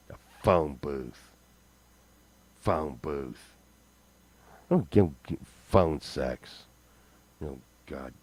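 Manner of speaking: low, soft mumble to self; low muttering. Real, not acted. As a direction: grumble it under your breath.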